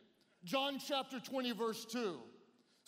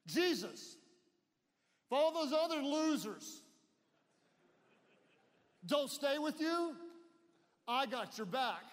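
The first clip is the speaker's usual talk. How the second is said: lightly hammed-up bragging, raised pitch; a hint of bold loudmouth, stretching the final vow; Peter; 4 semitones higher, 3 dB louder than usual. Peter bragging to Jesus about his loyalty, boastful. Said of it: "He liked to brag".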